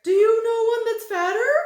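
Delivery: very high, loud, huffy question; impatient. A narrator's reading. Thin man answers Tock, high and impatient. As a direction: snap back, put out. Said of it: "he asked impatiently".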